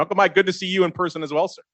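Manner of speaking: usual speaking voice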